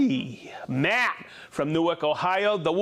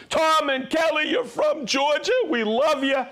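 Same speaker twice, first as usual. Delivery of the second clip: excited shout-out; 8 semitones higher than usual. Real, not acted.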